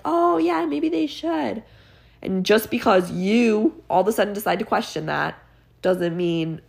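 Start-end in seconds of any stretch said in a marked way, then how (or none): 0.1-1.6 high, airy mock surprise; mock realization. Mocking past thinkers with a high, dawning voice. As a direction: act out a slow dawning in a high silly voice. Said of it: "they were finally like".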